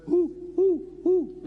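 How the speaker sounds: high hooting owl call, sharply pushed out; playful mock owl hoots. Shown as a demonstration. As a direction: hoot like an owl. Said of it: "You know the bird?".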